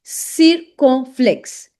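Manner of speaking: CIRCONFLEXE drawn out in separate syllables; slow syllable-by-syllable demo. Shown as a demonstration. Teacher introduces the next French accent, slow syllable-by-syllable. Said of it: "that is l'accent".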